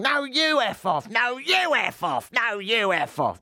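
high, slow hammed-up yelling; mock street row, fading; mock screaming women. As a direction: trade insults like furious women. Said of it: "they're shouting at each other".

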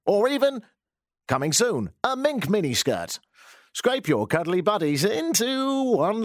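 hammed-up announcer voice, pitch swooping high; radio advert. Host pitches roadkill fashion in a mock ad, radio advert. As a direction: draw out the sales pitch like a commercial. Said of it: "advertise on News Talk ten ten".

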